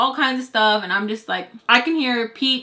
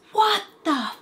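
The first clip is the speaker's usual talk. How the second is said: high yelled outburst; loud shout; 11 semitones higher than usual. Retold or imitated. Man upstairs yelling in shock, high loud shout. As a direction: belt it out in shock. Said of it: "Like, loud, loud, loud".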